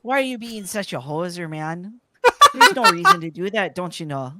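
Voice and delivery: a mock-Canadian telling a friend off; exaggerated Canadian accent. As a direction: push the Canadian accent for laughs. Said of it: "forcing my natural accent".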